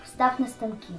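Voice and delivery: ordinary talk, nothing marked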